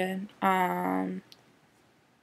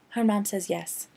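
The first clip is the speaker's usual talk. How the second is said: ordinary delivery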